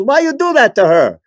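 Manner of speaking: put-on high accented outrage, laughing; indignant guy. Retelling the guy yelling at him after the crash, high mock outrage. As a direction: mimic the guy scolding, high and breaking up. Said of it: "and the guy goes".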